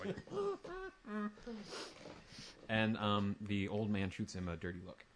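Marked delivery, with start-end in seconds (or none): 0.2-2.6 high, soft laugh behind a fist; stifled drow snicker. Acted. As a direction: snicker quietly as if hiding it. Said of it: "laugh behind his fist".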